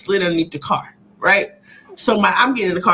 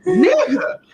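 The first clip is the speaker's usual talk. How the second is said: high, strained exasperated whine; incredulous at her cousin lying; 10 semitones higher than usual. Retold or imitated. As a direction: whine at the betrayal. Reacting to cousin's denial.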